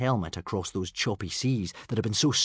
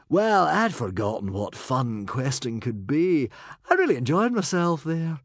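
breezy; 5 semitones higher, 4 dB louder than usual. A narrator's reading. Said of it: "beamed Humongously Hotshot the hero, breezily".

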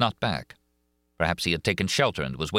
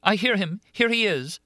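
put-on much higher, louder young woman's cry; Christine crying out; 3 dB louder than usual. A narrator's reading. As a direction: cry out as the frightened heroine. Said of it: "cried Christine".

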